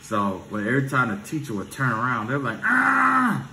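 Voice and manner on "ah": high loud mock throat-clear yell; kids mocking yell. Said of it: "Like that, right?".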